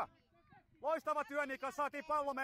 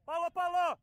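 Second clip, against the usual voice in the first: loud, repeated sideline shout; urgent call; 3 semitones higher, 6 dB louder than usual. Real, not acted. Coach calls for the ball during play, urgent shout. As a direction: shout it to the players, loud and insistent.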